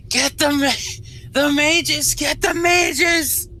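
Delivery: high, strained far-off shout; distant screaming guard. Acted. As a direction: scream the order as if from far away, stretching the last one. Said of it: "one distant voice off in the distance, someone screaming".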